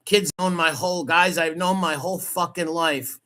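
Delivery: usual speaking voice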